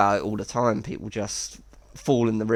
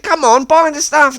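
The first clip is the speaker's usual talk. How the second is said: much higher, louder pushy plea; pushy [name]; 13 semitones higher, 8 dB louder than usual. Retold or imitated. [name] nagging Jeff to buy, much higher and louder. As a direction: nag him into buying. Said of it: "I was like".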